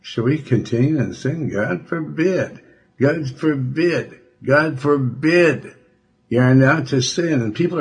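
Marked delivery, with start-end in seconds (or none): 1.5-5.8 higher, slow, each forbid weighted; preacher's slow refrain; solemn repetition. Acted. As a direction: let every God forbid ring out slowly. Preacher repeats God forbid.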